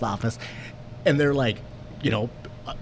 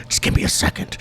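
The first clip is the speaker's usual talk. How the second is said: breathy plea. Retold or imitated. Calming himself before police.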